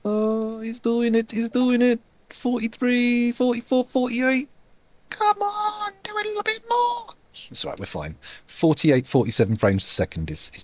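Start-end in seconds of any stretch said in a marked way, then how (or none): 0.0-4.5 excited cheering. Real, not acted. Cheering frame rate climbing. 5.1-7.1 high pleading whine. Real, not acted. Pleading for frame rate.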